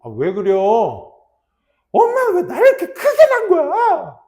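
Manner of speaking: very high, loud protest to mom; hammed-up furious schoolgirl blaming her mother; mock tantrum. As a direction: play a bratty outburst, building to a wail. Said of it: "막 승질을 부리는 거야".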